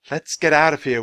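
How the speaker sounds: ordinary talk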